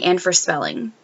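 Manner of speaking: ordinary talk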